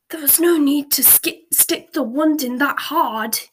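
a touch of a gruff aggrieved wizard; gruff complaint. A narrator's reading. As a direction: grumble like a sore, fat old man. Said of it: "he said gruffly".

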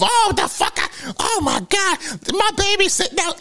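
shrill, way-up-high panicked mother; mama losing it over her baby stranded outside; mama going off. Retold or imitated. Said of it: "My mama went the fuck off".

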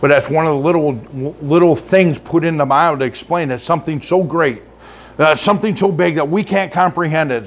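ordinary talk

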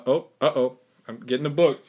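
normal talking voice

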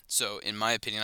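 ordinary delivery

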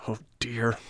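soft, whispery lament; despairing sigh. A narrator's reading. Puppet alone beside his hurt schoolmate, quiet despair. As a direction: let it out softly, in understated despair. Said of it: "And Pinocchio began to cry".

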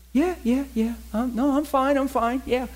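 high-pitched, hurried-sounding denial; overeager reassurance. Acted. Husband covering up to his wife on the phone, overeager reassurance. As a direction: insist all is fine in a high, too-cheery voice.

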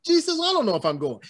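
high, fast mock whine; mock doubtful fan. Imitating a fan hesitant about the show, high and quick. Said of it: "You say".

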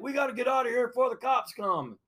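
high-pitched imitation of panic, without real volume; mock panicked yelling. Wrecked men urge fleeing before cops, mock panicked yelling. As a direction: urge them away in a high, held-back holler. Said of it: "arguing and yelling, screaming. They're like".

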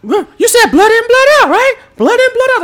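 very high, loud squealing manager voice; Jimmy Hart voice. Retold or imitated. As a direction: squeal it like an excited wrestling manager. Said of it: "Jimmy Hart is yelling".

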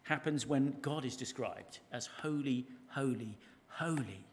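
solemn, slow "holy, holy, holy"; triple emphasis. Shown as a demonstration. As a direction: let each "holy" land with its own pause. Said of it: "which means go crazy with emphasis".

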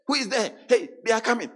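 high, loud panicked cry; mock terror of the dying sinner. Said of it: "they say".

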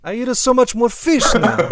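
high mock Italian accent. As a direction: confess it as a comic Italian. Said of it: "the Italian accent is approaching".

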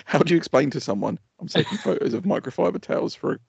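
exasperated mock shout. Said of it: "I was like".

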